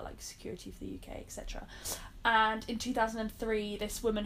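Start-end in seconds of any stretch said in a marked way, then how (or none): 0.0-1.7 quiet aside. Real, not acted. Aside in explanation.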